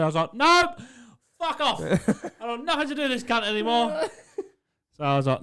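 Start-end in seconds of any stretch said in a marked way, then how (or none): 0.3-4.0 much higher, half-laughing shout of refusal; laughing mock yell. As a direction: yell it, cracking up. Retold yell at boss.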